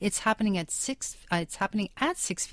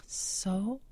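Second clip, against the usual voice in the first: whisper; 6 dB quieter, much slower than usual. Real, not acted. Whispered aside about her blog.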